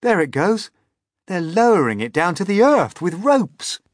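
high, loud commentary; loud amazement; excited cry. A narrator's reading. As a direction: cry out what you see. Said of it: "cried James".

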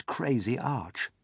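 ordinary delivery, nothing marked

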